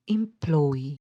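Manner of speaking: "Employee" with PLOY stressed; light first syllable, weighted PLOY in "Employee"; stress on second syllable. Shown as a demonstration. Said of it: "the second one is stressed".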